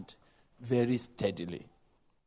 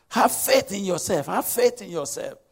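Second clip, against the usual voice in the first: urging psychologist; 6 semitones higher, 5 dB louder than usual. Acted. Voices psychologists urging a man on.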